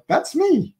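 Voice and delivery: light up at seeing yourself; proud recognition. Retold or imitated. Host claiming the Paul passage as himself, delighted. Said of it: "because I'm like".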